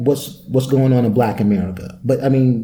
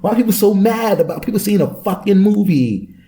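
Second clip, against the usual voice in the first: exasperated; 8 semitones higher than usual. Retold or imitated. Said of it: "I'm like".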